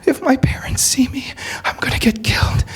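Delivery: high frightened whisper; whispered fear. Retold or imitated. The girl whispers that her parents would kill her, frightened. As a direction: breathe it out as a secret. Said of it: "And I'm like".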